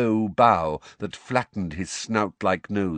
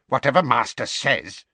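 muttered furiously; 6 semitones higher, 6 dB louder than usual. A narrator's reading. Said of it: "he muttered furiously".

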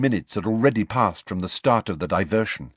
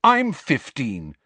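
higher, drawn-out indignant retort; heated; 6 semitones higher, 3 dB louder, slower than usual. A narrator's reading. Harry retorts to talk of his father's youth, heatedly. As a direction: throw it back heatedly, stretching the words. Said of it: "said Harry heatedly".

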